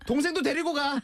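high-pitched mock mom voice, insisting; mom telling him. Mom telling her son to take his little brother along, high mock nag. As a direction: put on a nagging mother ordering him to bring the kid brother. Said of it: "엄마가 친구랑 놀러 가는데".

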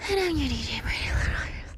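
breathy, whispered DJ sign-on; whispered ident. Pretend. DJ introducing herself to listeners, whispered. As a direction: whisper your radio name like a secret.

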